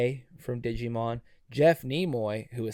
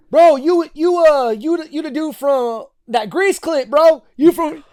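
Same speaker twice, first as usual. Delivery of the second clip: put-on high, loud, hyped-up young fan; excited fan; 12 dB louder than usual. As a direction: gush like a starstruck kid. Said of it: "and went".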